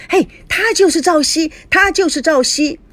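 excited. A narrator's reading. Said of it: "摊主兴奋地对路人说".

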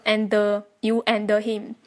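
and with an audible extra "duh", "you an-duh him"; sounded plosive. Shown as a demonstration. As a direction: overpronounce the d in and. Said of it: "不要读成".

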